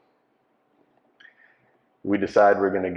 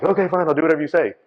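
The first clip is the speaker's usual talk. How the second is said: mock cowering; 9 semitones higher, 5 dB louder, faster than usual. Cowed victim gives in.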